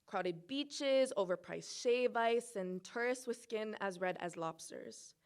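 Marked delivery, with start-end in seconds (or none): none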